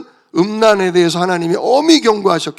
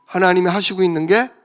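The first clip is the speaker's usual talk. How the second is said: usual speaking voice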